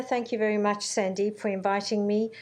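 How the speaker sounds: ordinary delivery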